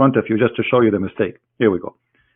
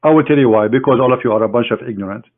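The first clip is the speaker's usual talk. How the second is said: ordinary talk, nothing marked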